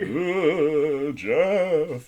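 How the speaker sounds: spooky announcer voice; drawn-out spooky announcer call; low haunting voice. Acted. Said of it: "announcer on S N L as our spooky voice".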